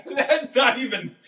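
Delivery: high, squealing laughter through the words; helpless laughter. Real, not acted. Co-host reacting to a wildly wrong guess, helpless laughter. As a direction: struggle to speak while cracking up.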